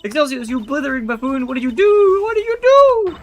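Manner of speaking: high, loud enraged screech; furious shriek. Acted. Warden screeching at the chairman, furious and high. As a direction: scream the blame at him.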